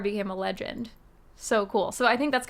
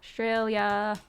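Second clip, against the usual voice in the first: normal talking voice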